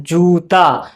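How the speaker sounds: "जूता" with the जू drawn out; long ū. Shown as a demonstration. Teacher dictating a word to spell, "जूता" with long जू. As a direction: hold the ū in "जूता". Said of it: "थोड़ा सा देर तक बोला गया है".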